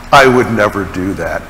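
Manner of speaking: low, understated self-assured denial; inner protest. Acted. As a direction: say it low, as a private thought. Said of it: "Now you may think".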